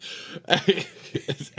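airy chuckle; breathy laugh. Real, not acted. Laughing at field trip joke.

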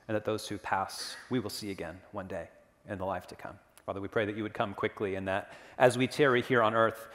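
praying quietly and earnestly; prayer. Acted. Pastor leads a prayer.

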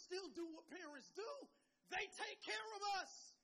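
loud, high, drawn-out preacher delivery; full-force preaching. Real, not acted. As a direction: preach it slowly to the whole room.

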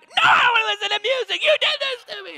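high, loud, fast mock scream of a wife in labor; wife in labor shouting at her husband; furious shout. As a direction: yell it at him like it's all his fault. Wife in labor yelling at him.

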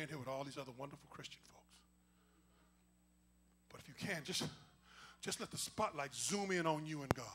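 soft, low, intimate prayer voice; hushed invitation to the altar; altar call. Real, not acted. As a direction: draw them close, gently.

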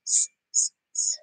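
S, s, s as a long voiceless hiss; hissing s sound. Shown as a demonstration. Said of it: "S sounds like".

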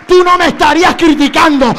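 normal talking voice

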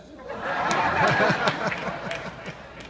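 breathy unvoiced laugh at the punchline; silent wheezing laugh. Real, not acted. Pastor laughing at his own joke: wheezy silent laugh.

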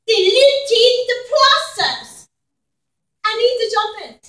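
high, loud German therapist; German accent. Acted. Therapist urging on her client, German accent. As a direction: call it out in a heavy German accent. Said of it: "had a German accent and gasped".

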